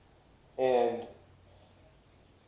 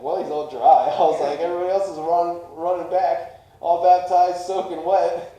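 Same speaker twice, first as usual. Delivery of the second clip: loud, higher, worked-up complaint; retold loud protest; 6 semitones higher, 12 dB louder than usual. Brother complaining he came back dry, loud protest. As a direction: protest it loudly, half laughing.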